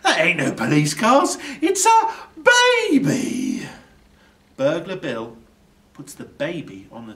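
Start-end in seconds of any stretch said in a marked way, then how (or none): 0.0-3.7 louder, higher disbelief rising on it's a; amazed. A narrator's reading. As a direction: build to the big reveal. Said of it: "he says".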